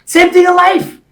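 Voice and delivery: loud, high, driving speech; motivational preaching. Real, not acted. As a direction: preach it to a crowd at full force.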